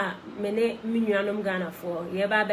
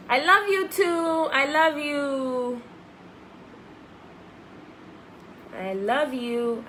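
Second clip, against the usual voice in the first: tender; 5 semitones higher, slower than usual. Real, not acted. Replying to fans' comments.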